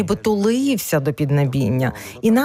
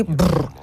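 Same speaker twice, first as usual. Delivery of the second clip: short lip flutter, "brrr"; lip trill. Shown as a demonstration. Trainer repeating the warm-up exercise, short lip trill. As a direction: flutter the lips in a brief trill. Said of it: "дві хвилини варто пофиркати".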